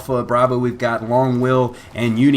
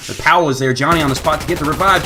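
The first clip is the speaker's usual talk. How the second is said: ordinary talk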